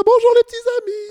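put-on very high squeaky nun voice greeting kids; bright squeaky sing-song welcome. Shown as a demonstration. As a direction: chirp it way up high like a cheery nun. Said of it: "Et je te parle un peu comme ça".

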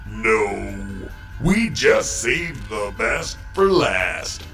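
ordinary talk, nothing marked

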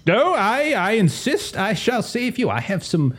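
high-pitched, gallant would-be hero; gallant rescuer. Acted. Gallant man promising a lady rescue, high heroic voice. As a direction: play a self-assured rescuer in a high voice.

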